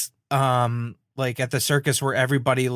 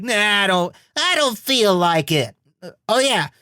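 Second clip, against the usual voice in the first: lightly put-on cartoon voice, dragging out the refusal; reluctant Rayman; 5 semitones higher than usual. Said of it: "he's just like".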